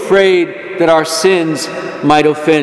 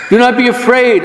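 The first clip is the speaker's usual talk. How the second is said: shouted plea; 8 semitones higher than usual. Acted. Jesus voiced, repeated plea.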